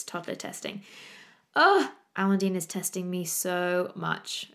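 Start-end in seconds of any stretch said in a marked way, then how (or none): 0.0-0.8 whispered aside. Shown as a demonstration. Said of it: "I've just written in my notes".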